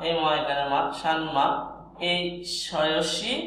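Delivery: ordinary talk, nothing marked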